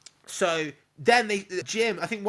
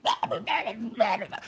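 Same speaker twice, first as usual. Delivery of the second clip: mock infected gibberish; 6 semitones higher than usual. Infected dad's garbled gibberish.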